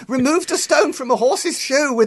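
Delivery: sending up her own eagerness in a squeaky mock voice; mock high-pitched self. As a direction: play the eager penknife owner, way up high. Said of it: "And I said".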